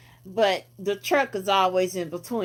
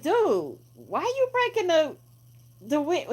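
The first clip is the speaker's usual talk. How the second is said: higher, demanding tone; confronting voice; 7 semitones higher than usual. Retold or imitated. The guy in pink confronting the window breaker, lightly voiced and higher. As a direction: call him out on it. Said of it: "he like".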